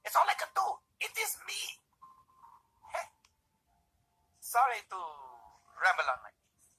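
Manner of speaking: very high, plaintive cop; hammed-up woeful cartoon voice.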